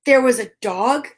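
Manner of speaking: gentle storybook reading; story narration. Acted. Parent reading Eric Carle to a child, gentle storybook voice. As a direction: read the picture book to a little one. Reads picture book aloud.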